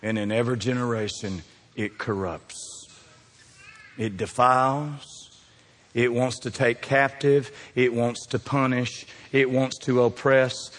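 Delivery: low, hushed, slow sermon voice; slow solemn preaching. Acted. Preacher warns the congregation about sin, slow and solemn. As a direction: lay each phrase down slowly and gravely.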